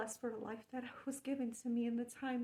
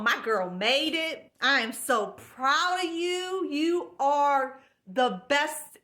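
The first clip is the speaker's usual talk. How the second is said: proud cheering ancestor; 3 semitones higher, 12 dB louder, slower than usual. Retold or imitated. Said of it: "she was going".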